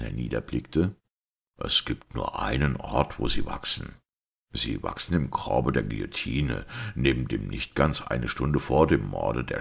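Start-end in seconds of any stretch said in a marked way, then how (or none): none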